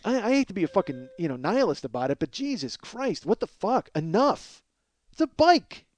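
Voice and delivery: high and loud, bursting out at the end; exasperated outburst. Acted. Fed-up worker protesting that it is only a bike, exasperated. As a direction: build to a fed-up, incredulous cry.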